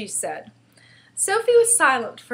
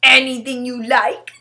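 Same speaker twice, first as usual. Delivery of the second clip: loud, boastful giant voice; proud giant; 3 semitones higher, 11 dB louder than usual. A narrator's reading. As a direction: declare it proudly, voice raised. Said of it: "the B F G said proudly".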